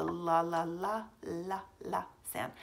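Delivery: "la" repeated as a short letter sound; L sound demo. Shown as a demonstration. Teacher introducing L blends to pupils, "la" sounded out. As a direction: sound out "la" several times over. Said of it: "these are gonna end in L".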